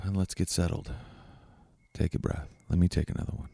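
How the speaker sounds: low, soft meditation voice; hushed, low-pitched breathing exercise; guided reflection. Real, not acted. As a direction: guide them gently inward, with long pauses. Leads a breathing and fear reflection.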